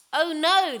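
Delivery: ordinary delivery, nothing marked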